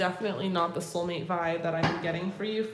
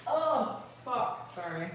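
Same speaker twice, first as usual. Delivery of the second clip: wincing while bending down; strained by sore muscles; 2 semitones higher, 5 dB quieter, slower than usual. Real, not acted. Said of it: "I'm so sore".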